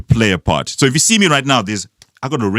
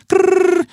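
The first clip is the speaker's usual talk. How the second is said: playful growl. Retold or imitated. Acted growl in phone story.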